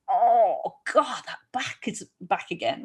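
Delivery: mock groan of returning pain; dismayed groan. Acts performer whose pain returns.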